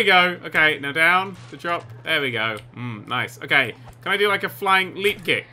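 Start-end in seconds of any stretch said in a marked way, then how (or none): none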